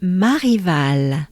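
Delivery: slow Marival with a breathy French r. Shown as a demonstration. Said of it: "second part of the name is".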